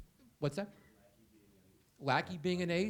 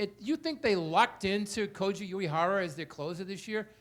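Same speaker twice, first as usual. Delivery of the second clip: ordinary talk, nothing marked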